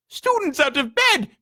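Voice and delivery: high, loud poltergeist bellow; ringing high alarm call, very loud. A narrator's reading. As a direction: shout it through the castle to rouse the caretaker. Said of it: "Peeves bellowed".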